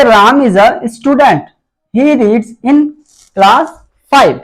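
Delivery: very slow, word by word with pauses; slow dictation. Shown as a demonstration. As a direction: dictate the example to the class. Teacher reads first example sentence. (बहुत धीरे, रुक-रुककर dictation)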